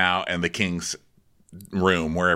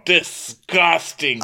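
drawn-out disgust; 8 semitones higher, much slower than usual. Real, not acted. Reacting with mock disgust.

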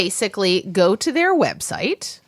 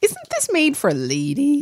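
voice cracking; 6 semitones higher, slower than usual. Real, not acted. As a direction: voice cracks up high. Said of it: "My voice just cracked".

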